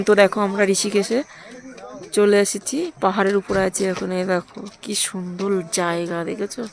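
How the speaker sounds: soft, kept-down tour talk; hushed narration. Real, not acted. Vlogger shows the hills to viewers after being hushed, hushed. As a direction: show the view while keeping your voice down. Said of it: "আস্তে কথা বল".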